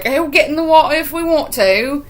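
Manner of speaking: high, defiant British-tinged retort; accidental British accent. Retold or imitated. Girls defying the old man, accidental British accent. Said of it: "I'm doing a British accent".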